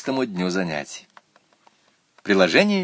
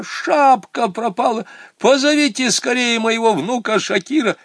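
put-on old woman crying out; 13 semitones higher, 4 dB louder than usual. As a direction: stretch it out high and loud. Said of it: "закричала бабушка". (громкий высокий вопль бабушки)

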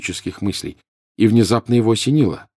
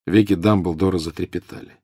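usual speaking voice

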